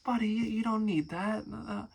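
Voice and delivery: imagined father gently telling his son he is loved; loving dad. Retold or imitated. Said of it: "his father would be like".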